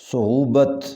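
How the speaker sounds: صعوبت with a rounded first vowel, "su'oobat"; correct pronunciation. Shown as a demonstration. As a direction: say صعوبت as su-OO-bat. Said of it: "صحیح پڑھیں گے".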